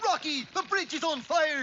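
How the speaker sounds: high, loud cartoon alarm; alarmed cry. Moose warns Rocky of the burning bridge, loud alarm. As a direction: cry out a panicked warning.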